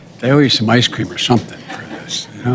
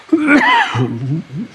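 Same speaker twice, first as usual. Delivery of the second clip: coughing laugh. Real, not acted.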